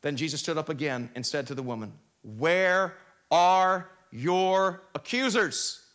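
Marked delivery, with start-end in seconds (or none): 2.2-5.9 very slow, word by word; solemn questioning. Acted. Jesus questioning the woman left alone, solemn questioning. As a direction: space out each word with weight.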